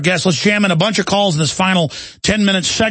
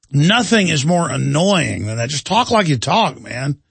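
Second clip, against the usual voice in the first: ordinary delivery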